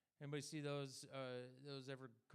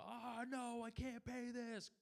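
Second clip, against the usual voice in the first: high, slow, hammed-up despairing wail; mock despair; 9 semitones higher, slower than usual. To the collector on the phone, hammed-up high despair. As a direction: play a fake doomed debtor. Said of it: "Just go".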